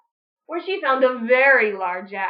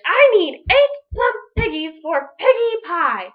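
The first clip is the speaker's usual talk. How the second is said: loud threatening demand; angry witch; 4 semitones higher, 8 dB louder than usual. Acted. Witch Grinch threatening the cow, loud.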